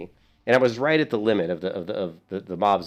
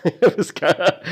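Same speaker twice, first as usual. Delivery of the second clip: laughing; 8 semitones higher, 5 dB louder than usual. Real, not acted. Laughing while recounting his death.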